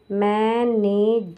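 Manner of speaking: Manage stretched very slowly; slow sounding out. Shown as a demonstration. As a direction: draw out manage for the students. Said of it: "जे ऊपर रहेगा".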